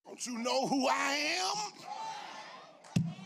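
slow, drawn-out commanding challenge; rebuking Jesus. Acted. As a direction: challenge the storm as its master. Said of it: "he rebuked them".

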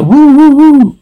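high, loud-ish "woo-woo" whoops; whooping. A narrator's reading. Reader voicing whooping in the distance, high whoops. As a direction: whoop high, three times over. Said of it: "Wooing continues".

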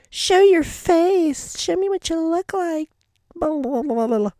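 mock demanding fan. Mocking fans who demand to see his face, squeaky high. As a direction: mimic pushy fans demanding a face reveal.

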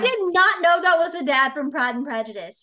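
loud, real astonishment; mind blown. Real, not acted. Host learning Snow is the Pride and Prejudice dad, astonished. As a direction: blurt it out in genuine disbelief. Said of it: "That just blew my mind".

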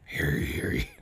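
hushed, low mock proclamation; whispered town crier. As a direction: whisper it like a secret herald. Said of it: "How would the Saw Doll in medieval times ask to play a game?".

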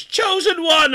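high loud call down into the pit; retold yell down a pit. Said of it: "he yells down".

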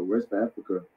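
ordinary talk, nothing marked

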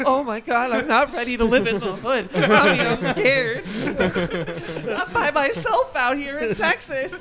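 hammed-up scared kid calling for mommy; mock scared. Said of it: "I'm like".

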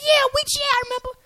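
high, loud mock young man; imitated eager agreement. Young brother answers the preacher, imitated high and eager.

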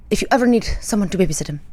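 lightly attempted Italian accent, quick; a shaky stab at an Italian neighbor's accent; imitated cute accent. Neighbor offering to babysit the dog, attempted Italian accent. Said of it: "in his little cute accent".

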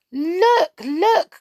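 high excited calling; excited cry. A narrator's reading. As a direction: call out in delight. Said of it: "cried the fairies".

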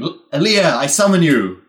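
loud reverberating call; yelling lich voice. Acted. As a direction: yell the summons so it echoes. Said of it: "just yelling".

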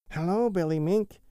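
a friendly otter bounding in; Little Joe Otter voice. A narrator's reading.

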